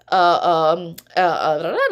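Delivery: ordinary talk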